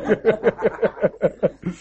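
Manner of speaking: laughing after the punchline; real laugh. Real, not acted. Laughing with the congregation at the punchline. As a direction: let the laugh roll out.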